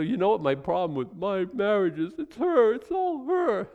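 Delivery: mock a husband shifting the blame; whiny complaining husband. Preacher mocking a husband who blames his wife, high whine. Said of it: "I say".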